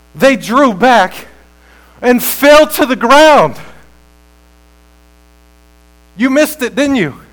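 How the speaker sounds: loud, high, slow proclamation; stretched, ringing sermon climax; full-force preaching. Real, not acted. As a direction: thunder it out phrase by phrase. Preaches the soldiers falling down.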